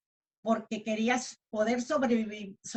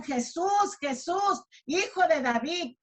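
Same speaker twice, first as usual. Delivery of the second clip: desperate cry; 4 semitones higher, slower than usual. Acted. Preacher voicing Bartimeo calling to Jesús, acted desperate cry. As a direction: imitate a blind man calling for help. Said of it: "empezó a gritar con mucha desesperación".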